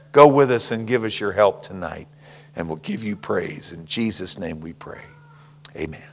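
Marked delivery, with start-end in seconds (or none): none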